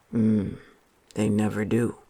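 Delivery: George's friend. A narrator's reading. Friend answering George on the path at dusk, low character voice. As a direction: drop well down in pitch for the friend. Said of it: "said his friend".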